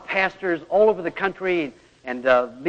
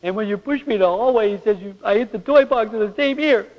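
put-on high, louder voice of a small boy explaining; hurt child; 5 semitones higher, 5 dB louder than usual. Son telling his father how the hallway push hurt his ear, in a high child's voice. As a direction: voice the little son telling what happened. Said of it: "And then he said".

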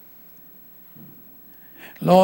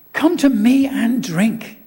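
calling out; 5 semitones higher, 5 dB louder, slower than usual. Retold or imitated. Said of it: "cried in a loud voice".

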